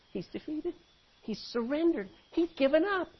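high, hushed disbelief; puzzled crowd. Acted. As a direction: question it softly, pitch lifted. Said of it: "The crowd thought that it was".

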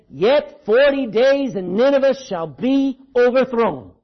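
slow, loud doom proclamation; harsh prophetic warning. Acted. Jonah preaching coming wrath to Nineveh, harsh warning. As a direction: proclaim it slowly and loudly, like a prophet. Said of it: "he's preached a harsh, me-, harsh message of warning".

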